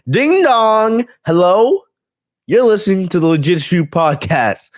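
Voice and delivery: high sing-song ding dong hello intro; mock podcast intro. Host imagining Bayley voicing his podcast intro, high sing-song. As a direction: chirp it as a cheerful show opener. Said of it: "get her to record that for the podcast".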